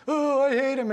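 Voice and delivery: high, loud hammed-up crying wail; mock tearful outburst. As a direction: wail it like a melodramatic sob. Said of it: "and she starts crying again".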